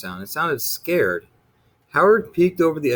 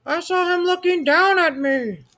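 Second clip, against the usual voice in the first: high, loud little monster shouting; a scared monster running to his mother; panicked shout; 3 dB louder than usual. A narrator's reading.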